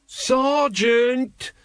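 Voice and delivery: high sharp urgent shout of the rank; urgent call. A narrator's reading. Carrot warning the sergeant, urgent high call. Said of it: "snapped Colon".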